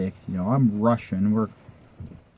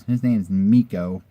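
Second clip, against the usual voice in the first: ordinary delivery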